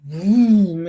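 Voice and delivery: spoken car-zoom noise; imitated engine vroom. Imitates car speeding past.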